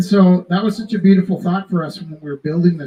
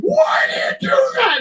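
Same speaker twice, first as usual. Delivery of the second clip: frantic store lady. Retold or imitated. Store lady yelling at the teller again, much higher crying mock shout. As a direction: play her near tears and yelling. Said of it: "She's like".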